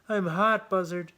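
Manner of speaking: high, loud complaint; hot squirrel. A narrator's reading. Squirrel complains to buzzard, high loud voice. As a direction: squirrel cries out, pitched well up.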